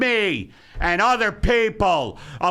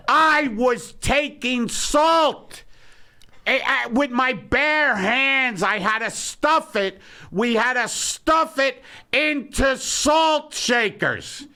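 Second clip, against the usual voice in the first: put-on old-timer grievance; 7 semitones higher, slower than usual. Bitter old-timer rant, drawn out.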